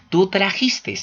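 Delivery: "trajiste" said with an extra final s, "trajistes"; wrong final s. Shown as a demonstration. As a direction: add a stray s to the end of "trajiste". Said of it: "no es".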